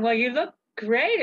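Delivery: ordinary delivery, nothing marked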